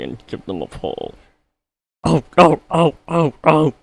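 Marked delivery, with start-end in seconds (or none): 2.0-3.7 high squealing cries, very loud; shrieking trucks in a panic; screaming trucks. A narrator's reading. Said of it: "screamed the trucks".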